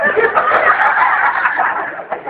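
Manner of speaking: carcajada aguda y gritada; risa chillona. Acted. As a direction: suelta una risa aguda y estridente. Risa chillona del compadre. (risa aguda a gritos)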